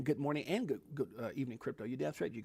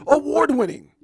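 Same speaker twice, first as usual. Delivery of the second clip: high booming hype voice, award pushed up; new announcer voice; 8 semitones higher, 10 dB louder than usual. Shown as a demonstration. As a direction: belt it out like a ring announcer. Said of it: "I don't know where that voice came".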